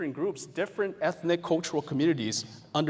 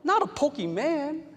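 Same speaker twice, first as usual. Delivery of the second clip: much higher, louder put-on voice, playfully scornful; mock-indignant player scolding a friend, faster on the question; outraged gamer; 6 dB louder than usual. Teacher voicing a gamer scolding a friend, mock outraged. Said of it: "You're like".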